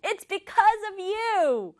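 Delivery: high, accusing outburst; acted anger. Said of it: "화가 막 나면서".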